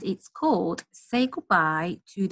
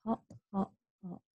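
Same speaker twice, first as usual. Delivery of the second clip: much softer hop hop; quiet sleepy hops; 11 dB quieter than usual. Pretend. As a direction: let the hops fade away. Rabbits settling.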